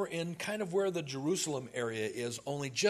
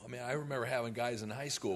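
ordinary talk

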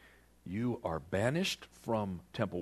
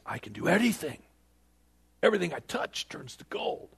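a touch of a cocky king; mildly boastful; 6 semitones higher than usual. Acted. Preacher voicing the proud king to the congregation, mildly boastful. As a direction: lightly act out a self-assured boast.

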